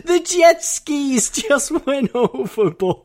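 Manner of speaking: high laughing disbelief. Retold or imitated. Friend retelling the film, laughing high. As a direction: squeal it out through laughter.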